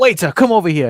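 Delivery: loud demanding call for the waiter; mock chef summons. Chef calling the waiter over, mock loud summons. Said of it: "Gordon Ramsay-esque".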